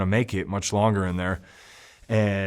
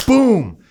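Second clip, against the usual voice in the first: a sudden loud, high "Boom!" for the punch; punch sound effect; 11 semitones higher, 6 dB louder than usual. Retold or imitated. Telling how he decked the guy, shouted boom. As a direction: slam out the impact like a hit landing.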